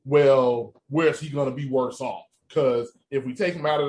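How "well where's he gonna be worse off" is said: ordinary delivery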